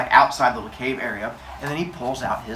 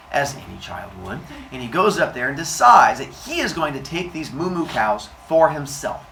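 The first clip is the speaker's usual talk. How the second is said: ordinary delivery, nothing marked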